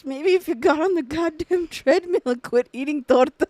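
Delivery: comic insult rant; mock scolding. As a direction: imitate a mom laying into someone. Acting out mom's insulting tirade.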